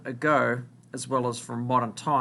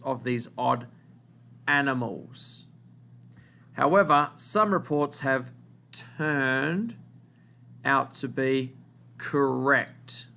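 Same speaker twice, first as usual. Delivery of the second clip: slow, phrase by phrase, with long pauses; slow dictation. Shown as a demonstration. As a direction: read it out slowly so the class can write. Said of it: "word that you need to write down".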